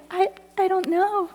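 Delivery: high, halting tearful reply; imitated helpless crying voice; mock crying. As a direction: act out being too weepy to explain. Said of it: "so I'm really crying".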